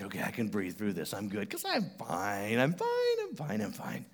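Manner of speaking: quiet panicky reassurance; talking himself through panic; mock nervous self-talk. Preacher retelling himself on the coaster, mock nervous. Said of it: "and I'm like".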